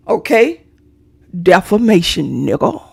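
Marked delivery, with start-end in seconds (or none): none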